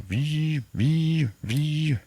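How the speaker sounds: vocal buzzing like a vibrating phone; phone buzz imitation. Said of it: "the phone buzzes".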